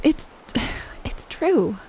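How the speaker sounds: whispered confession with a sigh; shy whisper. Acted. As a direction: whisper it, embarrassed to admit it. Shy confession after compliment.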